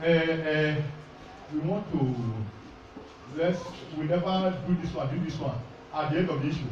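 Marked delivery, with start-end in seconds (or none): 0.0-5.5 soft, lower hesitant plotter; quoted plotter. Retold or imitated. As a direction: mutter like a scheming elder. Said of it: "the one person will join and say".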